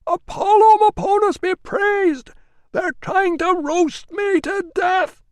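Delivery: very high, loud, slow desperate shout; panicked cry. A narrator's reading. Catavignus begging as they set the roof alight. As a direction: cry out in terror. Trapped man crying out.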